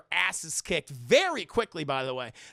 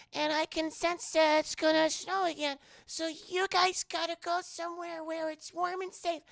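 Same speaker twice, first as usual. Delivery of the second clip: put-on high, gentle little-girl voice talking to animals; sweet girl voice; 11 semitones higher than usual. Little Yukina sending the animals home, high sweet voice. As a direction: coax the forest animals like a Disney princess.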